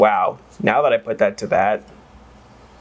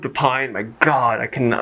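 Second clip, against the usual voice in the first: ordinary delivery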